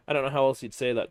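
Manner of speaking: normal talking voice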